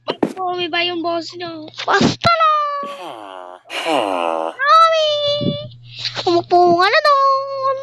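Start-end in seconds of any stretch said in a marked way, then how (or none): 1.9-7.8 high, loud put-on voice; teacher voice. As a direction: yell it as the teacher. Sir Pinocchio answers.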